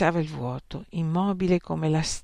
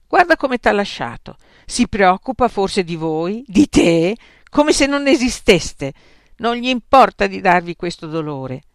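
higher, louder scolding; indignant; 4 semitones higher, 9 dB louder than usual. A narrator's reading. Plump friend urging Santippe to see how he left her, indignant. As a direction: press her point with disdain. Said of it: "esclamò con sdegno".

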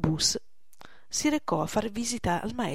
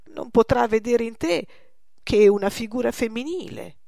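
raised, louder insistence; insistent persuading; 4 semitones higher, 6 dB louder than usual. Acted. Poussin reassures Gillette about the old man, insistent. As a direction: press the argument, higher and louder. Painter presses his lover to agree.

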